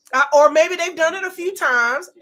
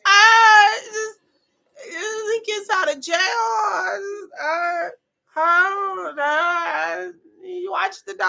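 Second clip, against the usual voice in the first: mock sobbing Ashley; 3 semitones higher, slower than usual. Imitating Ashley pleading with the neighbor, mock sob. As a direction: blubber through it like a crybaby. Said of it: "the way Ashley talk is like".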